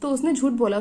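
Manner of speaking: plain reading voice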